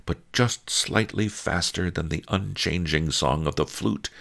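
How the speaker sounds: ordinary delivery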